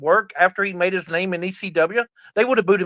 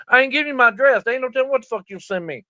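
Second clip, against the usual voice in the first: higher and a bit faster, fed up; exasperated refusal; 7 semitones higher, faster than usual. Retold or imitated. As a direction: push back hard, half laughing. Refusing again, more exasperated.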